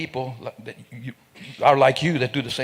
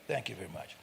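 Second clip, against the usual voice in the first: relieved; 6 semitones lower, 10 dB quieter, faster than usual. Retold or imitated. Relieved reply to soldier.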